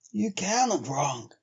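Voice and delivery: slow lightly voiced trail man; a hint of a stubborn old driver; Henry, flatly sure. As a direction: brush it off in Henry's voice. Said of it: "Henry answers Bill".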